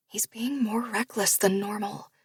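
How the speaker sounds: breathy unvoiced whisper. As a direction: whisper it close to his ear. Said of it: "I whisper to Brock".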